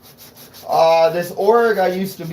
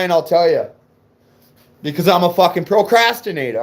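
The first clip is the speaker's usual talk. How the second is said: ordinary talk, nothing marked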